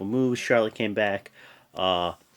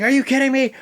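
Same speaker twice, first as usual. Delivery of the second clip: mock outraged Jericho; 13 semitones higher, 7 dB louder, faster than usual. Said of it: "He's like".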